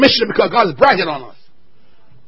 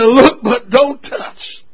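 even slower, stretched-out warning, rising on LOOK; slower, deliberate; much slower than usual. Shown as a demonstration. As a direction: drag it out even more for the joke. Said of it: "I said".

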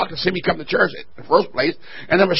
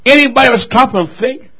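ordinary talk, nothing marked

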